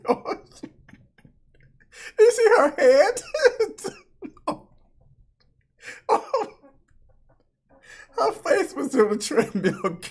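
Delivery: guilty laughter breaking into the words; laughing. Real, not acted. Viewer apologizing for laughing at her fall, laughing. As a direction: crack up, then try to apologize. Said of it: "I shouldn't be laughing".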